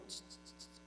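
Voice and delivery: soft voiceless shushes; a run of quick quiet shushes; gentle shushing. Acted. God quiets someone before a miracle, gentle shushing. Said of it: "a veces Dios te dice".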